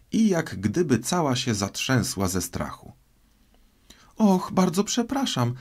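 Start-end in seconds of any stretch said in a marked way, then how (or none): 4.2-5.5 high, anxious exclamation of apology; flustered, high-pitched regret; hasty apology. A narrator's reading. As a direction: cry out sorry in a high girlish voice. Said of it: "zawołała z pośpiechem Alicja, w obawie".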